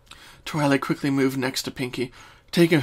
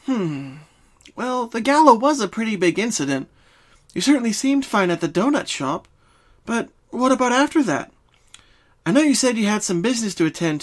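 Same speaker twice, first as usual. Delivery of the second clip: higher, brisk studious pony; Twilight voice; 7 semitones higher, 4 dB louder than usual. Acted. Twilight questions Pinky to find the dreams' cause. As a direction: ask like a focused young scholar.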